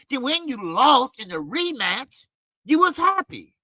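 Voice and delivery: usual speaking voice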